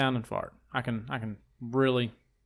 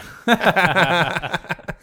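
laugh; 4 semitones higher, 7 dB louder than usual. Real, not acted. Laughing at wrecks joke.

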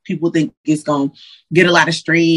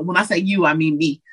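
ordinary talk

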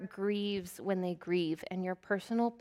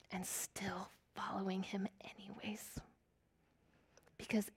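hushed earnest; 11 dB quieter than usual. Real, not acted. Earnest close on following God.

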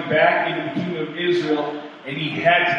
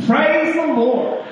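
high, slow, loud churchy cry; grandma yelling praise; 13 semitones higher, 4 dB louder, much slower than usual. Retold or imitated. Old grandma calling from her porch, joyful high cry. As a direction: call it out for the whole street to hear. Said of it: "she would yell out".

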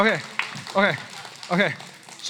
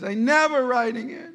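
put-on higher, whiny kid's refusal; upset child; 8 semitones higher, 3 dB louder than usual. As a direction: echo the sulking son. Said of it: "That's what he says".

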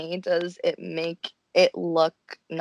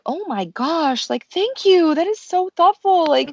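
gushing thanks; 8 semitones higher than usual. Retold or imitated. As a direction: gush over the favor, hammed up. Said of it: "Haruka is like".

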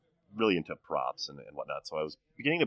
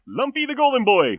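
high goofy voice; 12 dB louder than usual. Acted. Introducing guest jokingly.

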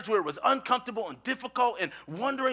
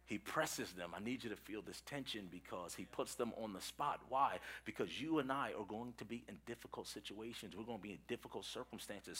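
low, very soft, earnest sermon delivery; quiet, weighty address to the congregation; hushed preaching; 9 semitones lower, 13 dB quieter than usual. Real, not acted. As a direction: drop right down to let the tension settle.

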